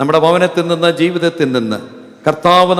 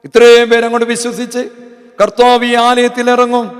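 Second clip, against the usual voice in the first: raised, ringing pulpit voice; high, loud revival preaching; fervent preaching; 6 semitones higher, 4 dB louder than usual. Acted. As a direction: preach it out to the whole church. Preacher rises to full force.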